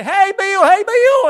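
high, loud call across the market; excited child shouting a friend's name. Retold or imitated. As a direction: hail a buddy from across the crowd. Kids calling friends at market.

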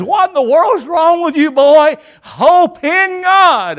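high, loud preacher's scolding; scold, then stretch out the command; rousing rebuke. Retold or imitated. Preacher acts out psalmist scolding himself, rousing rebuke.

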